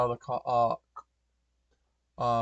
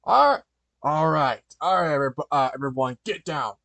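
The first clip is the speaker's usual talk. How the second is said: loud, forceful robber's order; barked demand; 9 dB louder than usual. Acted. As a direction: order the room down, loudly. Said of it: "Conrad demanded".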